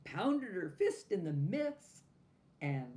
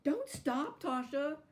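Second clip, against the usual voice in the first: very high, loud plea not to stop; crying out; 11 semitones higher, 6 dB louder than usual. A narrator's reading. Doll crying out to Tasha, high and loud. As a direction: wail at her to keep going. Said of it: "cried the doll".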